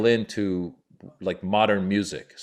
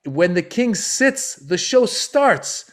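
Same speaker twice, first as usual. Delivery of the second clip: high, slow, loud proclamation; emphatic promoter; 11 semitones higher, 5 dB louder, much slower than usual. Retold or imitated. Promoter warning the comedians about the king, slow emphatic decree. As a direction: lay down the royal rule word by word. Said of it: "He's sweating".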